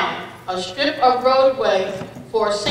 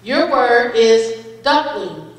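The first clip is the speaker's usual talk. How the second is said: pronouncer voice; 4 dB louder than usual. Acted. Spelling bee pronouncer reading word.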